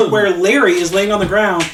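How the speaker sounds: ordinary delivery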